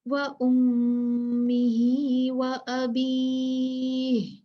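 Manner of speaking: very slow, chanted Arabic recitation; slow melodic Quranic chant. Acted. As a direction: recite it in slow, measured tajweed.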